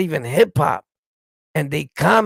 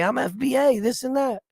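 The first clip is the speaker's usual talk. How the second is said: mocking whiny commenter; 8 semitones higher than usual.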